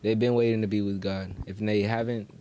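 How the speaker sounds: ordinary talk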